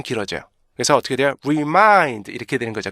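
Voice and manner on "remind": "remind" with the first vowel dragged out; slow, sagging "reee-mind"; drooping long REMIND. Shown as a demonstration. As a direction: mimic learners overstretching the start of "remind". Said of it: "그래서 처지게 돼요".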